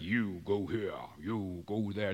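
a hint of a bossy deep-voiced God; lightly voiced commanding God; mock dictator God. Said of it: "not exactly in that voice".

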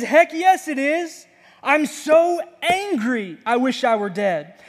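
high, loud and sulky, dragging "so angry"; angry Jonah. Acted. Jonah answers God, high and furious. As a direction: spit it out like a petulant prophet. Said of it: "He says".

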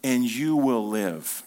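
"and you will live" drawn out slowly, each word weighted; slow right down and lean on "live"; slow emphasis. Shown as a demonstration. Preacher spelling out Jesus' promise, slow and deliberate. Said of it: "listen to this".

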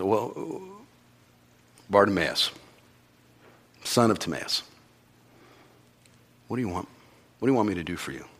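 soft, tender asking; Jesus tenderly asking the blind man; gentle question. Retold or imitated. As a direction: ask him quietly and kindly.

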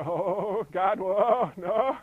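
mock coaster fright. Mimicking roller coaster fright.

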